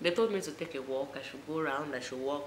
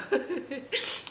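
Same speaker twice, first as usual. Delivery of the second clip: high amused laugh; 10 semitones higher than usual. Real, not acted. Laughs at her own story.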